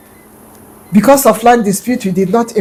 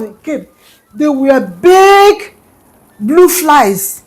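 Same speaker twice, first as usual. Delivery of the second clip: plain reading voice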